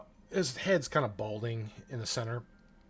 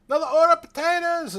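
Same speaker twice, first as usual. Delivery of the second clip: high, loud, fast order shout; loud sassy barmaid calls; 14 dB louder, faster than usual. Acted. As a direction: yell it into the kitchen. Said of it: "they shout".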